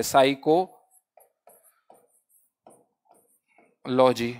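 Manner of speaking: साइको then a pause, then लॉजी, split into two parts; pronunciation demo. Shown as a demonstration. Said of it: "इसकी जो pronunciation है वो कुछ ऐसी आती है". (उच्चारण का प्रदर्शन)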